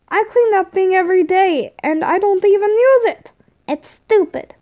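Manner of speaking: put-on high, indignant kid voice; complaining kid. A narrator's reading. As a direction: protest like a sulky child. Tom arguing with Tord.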